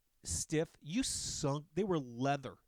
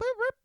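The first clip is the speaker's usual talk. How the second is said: sharp high beep noise; loud squeaky mock beep; radio beep imitation; 9 dB louder than usual. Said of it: "like, a little boop".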